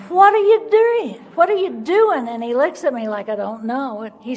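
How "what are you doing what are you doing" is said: loud, high-pitched indignant holler; retold holler. Wife hollers across the restaurant table at her drinking husband, indignant holler. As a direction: holler the question, pitch leaping up. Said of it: "I holler at him".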